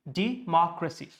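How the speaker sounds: democracy as "dee-MOCK-ruh-see"; democracy with a distinct MOCK and reduced ending; stress on MOCK. Shown as a demonstration. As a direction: hit the second syllable of democracy, MOCK. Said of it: "the stressed portion is mark".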